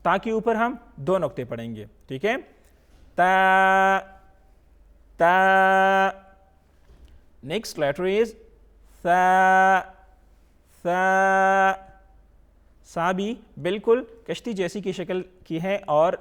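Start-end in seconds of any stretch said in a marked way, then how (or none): 3.2-6.1 تا held long and loud on a level note; drawn-out letter. Shown as a demonstration. Teacher drilling the next letter, تا loudly stretched. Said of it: "اس کو تھوڑا سا کھینچ کے پڑھنا ہے". 9.0-11.7 ثا held long and loud on a level note; drawn-out letter. Shown as a demonstration. Teacher drilling the next letter, ثا loudly stretched. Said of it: "اس کو تھوڑا سا کھینچ کے پڑھنا ہے".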